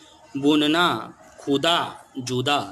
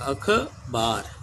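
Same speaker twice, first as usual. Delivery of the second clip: slow drawn-out word; 2 semitones lower, 3 dB quieter, much slower than usual. Shown as a demonstration. Teacher dictating to children, very slow. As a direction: stretch अखबार slowly, every syllable clear. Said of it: "ऐसे ही अलिफ जबर".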